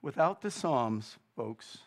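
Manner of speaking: ordinary delivery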